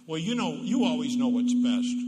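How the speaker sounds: loud, mocking you-know-best; sarcastic barb. Spouse jabbing partner, loud mock sarcasm. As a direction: hammer home the sarcasm. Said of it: "that little barb on the end of it".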